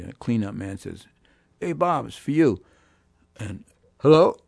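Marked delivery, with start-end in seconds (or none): 1.6-2.6 calling out. Retold or imitated. Cleanup man calls him to phone. 4.0-4.4 raised, loud phone hello; answering the phone. Retold or imitated. Bandleader answers the club phone, loud hello.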